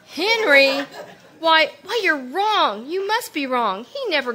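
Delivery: gasping disbelief at the name; shocked gasp. Acted. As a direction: caught completely off guard, disbelieving. Shocked by the news.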